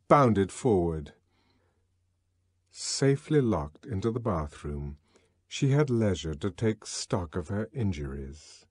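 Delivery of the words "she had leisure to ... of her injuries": plain reading voice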